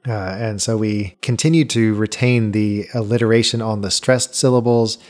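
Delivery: ordinary delivery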